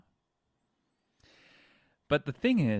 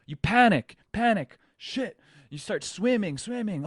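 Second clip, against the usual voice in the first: much higher, loud panicked bursts; 10 semitones higher, 8 dB louder than usual. Pretend. Surfer losing it underwater, panicked loud high bursts. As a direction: blurt it like the air is gone.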